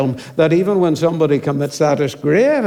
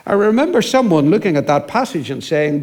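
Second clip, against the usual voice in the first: ordinary talk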